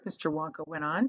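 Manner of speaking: ordinary delivery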